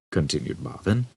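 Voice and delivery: ordinary delivery, nothing marked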